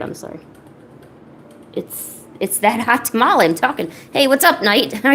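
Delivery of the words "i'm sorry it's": whispered apology. Real, not acted. Very quiet apology.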